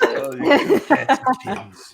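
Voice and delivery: laughing catchphrase. Retold or imitated.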